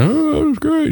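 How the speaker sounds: very high imitated phone voice; voice on the other line. Overheard caller on the other line, high put-on voice. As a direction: mimic a voice leaking from the phone, high. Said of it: "I could hear the, on the other line".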